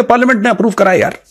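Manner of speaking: the agency shrugging off blame, lightly mocked; mocking the agency. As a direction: brush it off the way the agency would. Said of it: "ये बोलेंगे".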